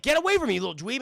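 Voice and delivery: loud, high irritated yell; mock big-brother outburst; irritated brush-off. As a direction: shoo off a pesky little brother, loudly. Acted snapping at pestering little brother.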